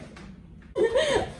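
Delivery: a high, bubbly burst of laughter; excited laugh. Real, not acted. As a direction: laugh with pure delight at the shoes. Excited about her new shoes.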